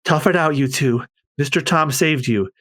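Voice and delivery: high, fast urging; stern comfort. Acted. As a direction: tell the boys to be strong. Kokoro comforts apprentices.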